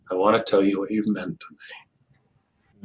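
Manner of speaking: slow, whispered tender line; hushed whisper. Retold or imitated. Fred writing to a suicidal friend, whispered slowly. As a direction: let it fall to a whisper. Fred's tender email, quoted.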